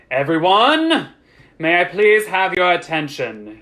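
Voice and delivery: loud, drawn-out address; calling for attention. A narrator's reading. Master of the house addresses crowd, calling for attention. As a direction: call the crowd to order. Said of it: "raised his hands".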